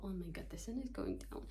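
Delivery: usual speaking voice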